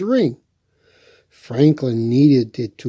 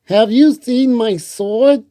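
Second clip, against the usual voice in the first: put-on higher, louder worried child voice; Franklin asking; 9 semitones higher, 5 dB louder than usual. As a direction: call out anxiously as young Franklin. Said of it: "Franklin asked his mother".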